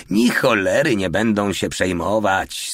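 ordinary delivery

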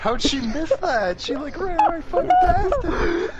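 high disbelieving shout; can't believe it, way up high; incredulous. Real, not acted. Incredulous at a miss.

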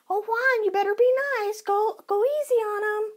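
high mock pleading to Juan; hammed-up sweet pleading. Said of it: "imitating her pleading with Juan".

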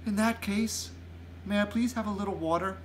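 put-on a child's careful plea; polite little girl. A narrator's reading. Sophie voiced asking giant for water.

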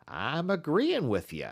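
high, teasing dog musher voice; the musher needling Scott, slyly agreeing; Matt's sly voice. A narrator's reading. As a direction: agree with a hidden snicker. Matt voiced by narrator.

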